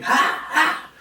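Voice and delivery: fighter's warm-up shouts; retold screaming. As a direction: bark out fighting yells. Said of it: "And I'm screaming".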